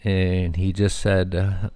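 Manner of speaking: ordinary talk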